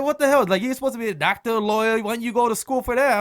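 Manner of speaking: put-on half-laughing teenage protest; indignant protest. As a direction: push back at dad in mock outrage. Son protesting to dad, retold.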